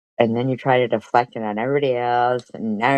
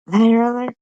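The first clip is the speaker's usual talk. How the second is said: sharp incredulous literally, much higher and louder; mock disbelief; 12 semitones higher, 6 dB louder, faster than usual. Real, not acted. Streamer scoffs at her rival's claim, incredulous. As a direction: throw it back in scornful disbelief.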